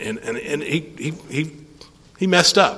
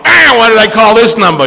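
loud, high outburst imitating a caller; mock-exasperated yell down the phone; worked-up caller; 9 semitones higher, 12 dB louder than usual. Irate caller on the phone retold.

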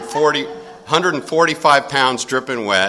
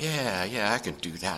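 playing it cool; 2 semitones lower, 9 dB quieter than usual. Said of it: "I kinda played it off like".

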